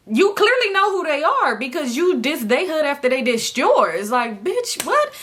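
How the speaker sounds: hammed-up disbelief; exasperated outburst. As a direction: call her out, incredulous. Angry retort at girl.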